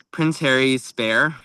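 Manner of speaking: ordinary talk, nothing marked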